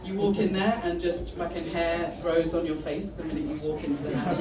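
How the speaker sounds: ordinary talk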